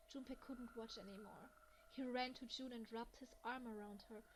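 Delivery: ordinary talk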